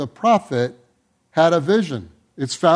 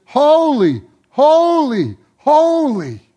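awed cry; 9 semitones higher, 6 dB louder, slower than usual. Acted. Elders respond to glimpse of God.

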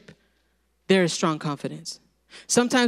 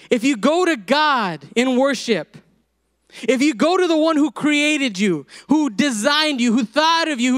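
higher, emphatic preacher's delivery; impassioned preaching; 5 semitones higher than usual. Real, not acted. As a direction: drive each phrase home from the pulpit.

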